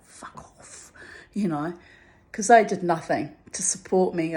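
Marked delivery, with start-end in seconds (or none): 0.0-0.9 a breathy under-the-breath "fuck off"; whispered curse. Retold or imitated. Thinking it at the staring mechanics, whispered. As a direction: mouth it almost silently, much softer. Silent thought about useless bystanders.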